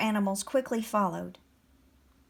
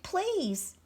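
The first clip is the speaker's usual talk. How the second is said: high-pitched plea; begging; 9 semitones higher, 3 dB quieter than usual. A narrator's reading. Animals plead with the dragon, begging. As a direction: implore them in one word. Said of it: "the animals begged".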